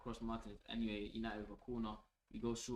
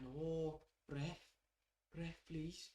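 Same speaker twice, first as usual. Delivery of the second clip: badly faked Scottish accent, higher-pitched; 6 semitones higher, much slower than usual. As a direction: plead with the referee in a poor Scottish accent. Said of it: "That's a shit Scottish accent".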